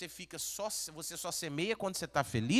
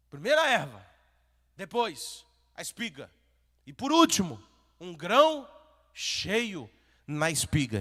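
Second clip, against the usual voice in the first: loud, rising sermon build; fiery pulpit preaching; impassioned preaching; 9 dB louder than usual. Acted. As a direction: preach it out with fire. Preaching at full force.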